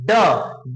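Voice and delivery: ड as an isolated, low "duh"; phonics letter sound. Shown as a demonstration. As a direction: give the sound of ड, not the letter name, falling. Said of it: "डी का sound होता है ड।".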